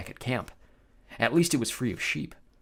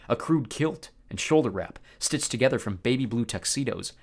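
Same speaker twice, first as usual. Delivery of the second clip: ordinary delivery, nothing marked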